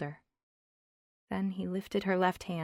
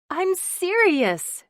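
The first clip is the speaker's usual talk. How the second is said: higher, loud, slow and insistent; 12 semitones higher, 11 dB louder, much slower than usual. Acted. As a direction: she wants him to take her seriously. Wife insists, Finn teases.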